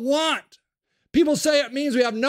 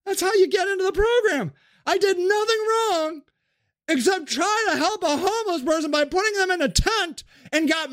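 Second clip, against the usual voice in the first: high, raised aggrieved rant; ranting Sage; 6 semitones higher than usual. Acted. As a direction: insist you did nothing wrong.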